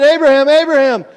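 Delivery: loud, high call from heaven; ringing heavenly call; angel calling. Acted. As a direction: call down from the sky to stop him. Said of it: "a voice of an angel spoke from heaven".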